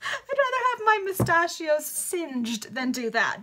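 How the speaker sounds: high, slow, laughing reread; real laughter on the corrected line. Real, not acted. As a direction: crack up while rereading.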